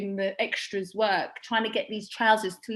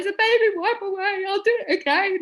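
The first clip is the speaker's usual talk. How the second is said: higher, louder through laughter; laughing retelling; 9 semitones higher, 4 dB louder than usual. Retold or imitated. Recalling telling her crying sister it wipes off, laughing. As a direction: blurt it out while laughing. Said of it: "And I was like".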